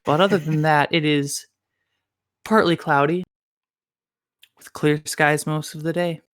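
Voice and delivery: a hint of public-radio morning host; lightly put-on ASMR-style radio voice; NPR radio voice. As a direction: read the time like an NPR host. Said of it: "also known as my N P R voice".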